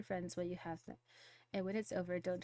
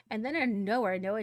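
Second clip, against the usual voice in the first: louder, punched reveal; excited build-up; 2 semitones higher, 9 dB louder than usual. Real, not acted. Teen telling her dad the drama, loud and excited. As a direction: hit it like a sudden twist.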